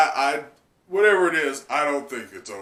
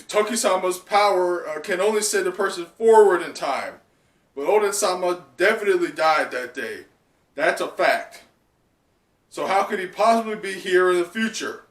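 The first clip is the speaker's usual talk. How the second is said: louder, higher firm reasoning; Raizo insisting; 5 semitones higher, 6 dB louder than usual. Acted. Raizo arguing Oden cannot be here, raised insistence. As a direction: argue it hard, voice lifted. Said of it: "I believe Raizo continues".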